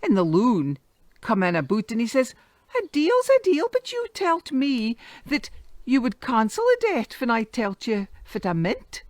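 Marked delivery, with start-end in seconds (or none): 2.8-9.0 put-on boy's voice. Boy confronts moneylender.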